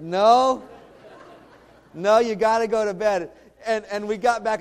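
laughing through a retold bedtime order; can't keep a straight face, laughing. Dad laughing while telling daughter to bed. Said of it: "the whole time I'm just cracking up".

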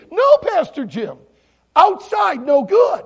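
slow, high, shouted warning; screaming children. Retold or imitated. Children warning the pastor about the peel, screaming. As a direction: holler each word like panicked kids. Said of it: "they all started screaming".

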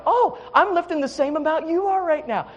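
high-pitched mock taunt; imitating a taunting workout partner. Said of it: "taunting me with it".

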